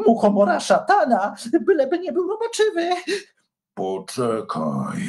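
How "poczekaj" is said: slow, puffing dragon panting out the word; a bit out of breath, drawn out. A narrator's reading. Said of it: "wysapał Wincenty".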